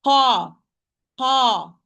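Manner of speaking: a heavy uvular qa; deep qaf. Shown as a demonstration. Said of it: "This is qaf".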